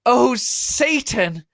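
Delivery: very high, loud, slow cry; yelled. Acted. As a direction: howl it to the rafters. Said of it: "yelled Harry".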